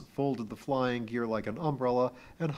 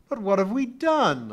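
sudden loud outburst; burst out; 10 semitones higher, 9 dB louder than usual. A narrator's reading. Kip bursts out at Pee-wee, loud. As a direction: blurt it out hard. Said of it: "I burst out".

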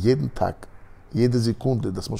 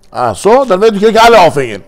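higher and louder mocking menace; sneering threat; 9 semitones higher, 12 dB louder than usual. Guard to the girls, sneering loud threat. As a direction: sneer the sentence at them.

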